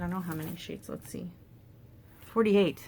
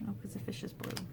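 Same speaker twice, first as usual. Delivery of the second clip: soft breathy aside, much softer; whispered realisation; 8 dB quieter, faster than usual. Real, not acted. Crafter noticing the fish colour, whispered realisation. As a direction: let it slip out under the breath.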